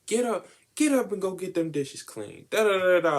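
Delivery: high, loud nagging mother; mock nagging mom yelling orders. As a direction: bark it like a naggy mom. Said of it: "where if she's like".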